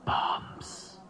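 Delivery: whispered secret, voice dropped. Acted. Seliger telling Engel of bombs in a whisper. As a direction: breathe the word like a secret.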